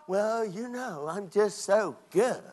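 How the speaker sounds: slow, softened mock-smug voice; stuck-up, self-righteous. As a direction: play someone with their nose in the air. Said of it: "got your nose stuck in the air".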